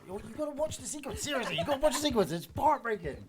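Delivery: rave, high and quick, with a laugh; excited gush. Real, not acted. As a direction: gush about the scene you love. Raving about the film scene.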